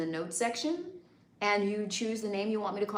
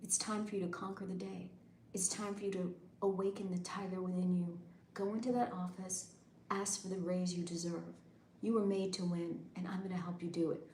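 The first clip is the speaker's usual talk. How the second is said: hushed motivational coach; motivational whisper; 9 dB quieter than usual. Shown as a demonstration. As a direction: quietly pump them up. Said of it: "motivation ones where I say".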